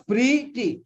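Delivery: "pretty" split slowly as pre, then tee; syllable by syllable. Shown as a demonstration. Teacher explaining why it is two syllables, syllable by syllable. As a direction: break "pretty" into two high, separate beats. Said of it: "two syllables".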